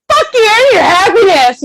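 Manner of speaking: high-pitched comic outrage; hammed-up indignation, close to laughing; mock angry outburst. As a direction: playfully curse them out, pitched high. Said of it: "I'm fucking angry all the time".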